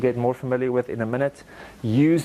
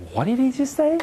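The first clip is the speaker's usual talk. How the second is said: baffled listener; 11 semitones higher than usual. Acted. Acts out a confused listener's question.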